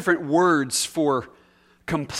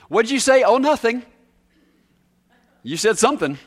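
higher, louder mock question and dodge; mock exchange; 7 semitones higher, 6 dB louder than usual. Preacher acting out a caught grumbler, higher and louder. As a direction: act out someone caught grumbling.

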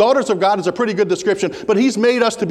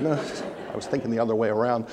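sheepish reply; 9 semitones lower, 8 dB quieter, faster than usual. Retold or imitated. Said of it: "And I said".

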